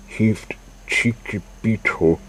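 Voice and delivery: ordinary delivery, nothing marked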